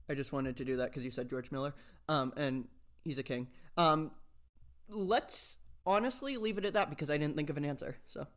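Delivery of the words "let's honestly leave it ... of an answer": ordinary talk, nothing marked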